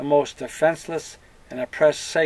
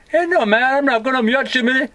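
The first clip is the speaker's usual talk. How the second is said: indignant refusal; 10 semitones higher, 5 dB louder than usual. Pretend. Acted worker refusing the job.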